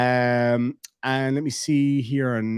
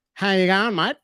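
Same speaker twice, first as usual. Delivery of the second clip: put-on Australian accent; 4 semitones higher than usual.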